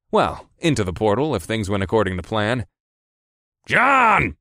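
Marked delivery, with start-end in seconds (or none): 3.7-4.3 high, loud, growled shout; angry growl. A narrator's reading. Boss calls his distracted driver to attention, growling. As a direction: bellow the name in exasperation. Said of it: "Marsh growled again".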